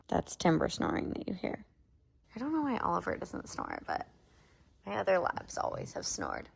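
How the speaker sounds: quiet so the dog sleeps; hushed. Real, not acted. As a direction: near whisper, soft and hushed. Dog snoring beside her.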